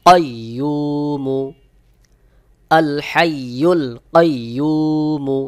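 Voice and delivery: কাইয়ুমু with a hard, rounded qaf, slowly. Shown as a demonstration. Teacher showing students the hard qaf in কাইয়ুমু. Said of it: "শক্ত করে গোল মতো করে আওয়াজ হবে".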